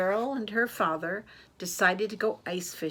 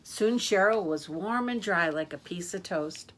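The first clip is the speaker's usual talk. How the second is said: plain reading voice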